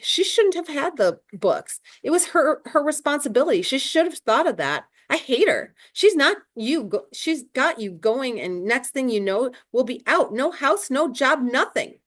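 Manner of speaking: upset Mildred. A narrator's reading.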